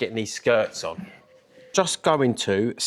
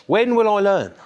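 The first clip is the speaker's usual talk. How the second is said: exasperated; 10 semitones higher, 6 dB louder than usual. Real, not acted. Self-scolding after a mistake.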